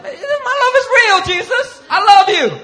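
very high, loud, laughing falsetto protest; mock pleading Peter. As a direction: squeak out a desperate denial. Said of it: "Peter's like".

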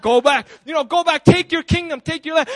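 loud, urgent acted exhortation; hammed-up heroic rallying cry; rousing command. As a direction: urge him on at near-shout, pitch climbing. Retelling film hero's call to return.

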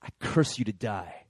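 low, restrained menace; angry curse. Acted. Warlock angered by her answer, low acted curse. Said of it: "He gets so angry".

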